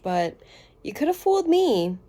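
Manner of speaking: ordinary talk, nothing marked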